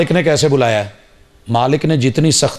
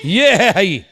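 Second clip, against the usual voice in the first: stern shout; 9 semitones higher, slower than usual. Retold or imitated. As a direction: bark the son out of the toy aisle. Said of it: "پھر باپ نے کہا".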